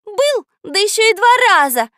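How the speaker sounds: high, loud derisive echo; mock him with amused disbelief; laughing mockery. A narrator's reading. Girl laughs at the boy's claim, mocking. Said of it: "засмеялась Катя".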